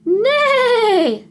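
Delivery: loud, high whinnying neigh; whinny like a horse. Acted. Horse on the tractor, loud high whinny. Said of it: "said Horse".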